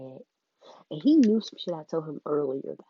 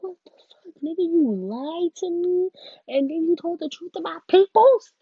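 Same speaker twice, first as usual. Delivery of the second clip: retold angry outburst; 12 semitones higher, 10 dB louder than usual. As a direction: yell it out in disbelief, stretching the words. Retold outburst at lying guy.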